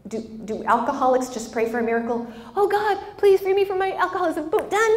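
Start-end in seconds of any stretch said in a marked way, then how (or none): 2.5-4.9 high, hammed-up plea to heaven; breezy high-pitched fake prayer; mock pleading prayer. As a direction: pray in a high, naive mock voice.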